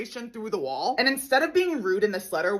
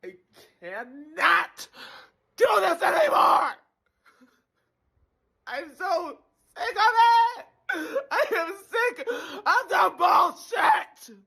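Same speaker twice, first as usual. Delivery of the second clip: screaming in frustration, slow; screaming rant; 5 semitones higher, slower than usual. Acted. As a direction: yell it out like a breakdown.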